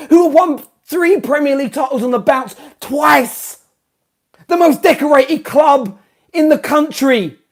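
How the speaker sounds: rising exasperated tirade ending in a yell; building rant. Real, not acted. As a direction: climb to an outraged cry. Fan ranting about club's glory.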